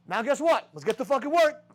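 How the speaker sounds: ordinary talk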